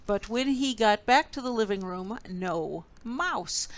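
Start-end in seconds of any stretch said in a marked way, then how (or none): none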